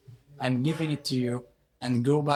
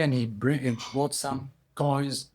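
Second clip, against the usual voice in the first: usual speaking voice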